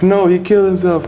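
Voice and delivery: distraught sister. Retold or imitated. Sister crying out the news.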